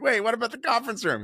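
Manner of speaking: pitched high in mock alarm; squeaky mock worry; retold dismay. Retold dismay over lost room.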